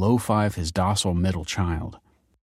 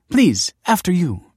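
beaming; 11 semitones higher, 6 dB louder than usual. Acted. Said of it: "beamed Nina".

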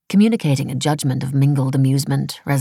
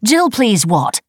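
raised, louder indignant challenge; offended; 7 semitones higher, 6 dB louder, slower than usual. A narrator's reading. Wife challenging husband's brush-off, offended. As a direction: demand he finish, affronted. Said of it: "she said, offended".

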